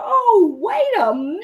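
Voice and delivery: very high, startled exclamation; mock-startled squeak. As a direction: caught off guard. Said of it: "I'm like".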